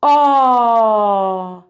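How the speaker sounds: a long, easy open "A."; held vowel. Shown as a demonstration. Teacher demonstrating vowels for students to repeat. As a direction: let the "A." flow out with no effort. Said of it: "vai repetindo comigo".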